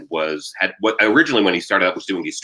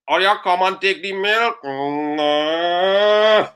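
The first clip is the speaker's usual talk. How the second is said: higher Trinidadian moan, sliding upward; whining Trinidadian accent; 9 semitones higher, much slower than usual. Acted. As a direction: complain like a mooing Trini cow. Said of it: "constantly whining and complaining".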